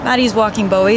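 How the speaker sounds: usual speaking voice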